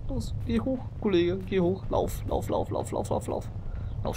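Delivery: high, frantic urging; being chased. Real, not acted. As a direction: hurry it along in panic.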